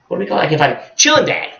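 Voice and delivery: ordinary delivery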